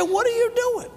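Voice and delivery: very high-pitched mock disbelief; squeaky-high acted astonishment; retold incredulous question. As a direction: imitate an appalled boss, voice way up. Retold confrontation with the employee.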